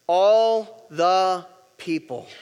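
very slow, word by word; slow group recitation. Shown as a demonstration. Preacher leading the congregation to say it along, slowly. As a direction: lead the room, each word drawn out and separate. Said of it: "say those next three words with me".

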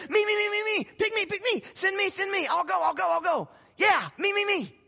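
high, loud, frantic pick-me shouting; eager volunteering. Retold or imitated. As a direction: beg to be chosen like a kid waving a hand. Isaiah eagerly volunteering, retold.

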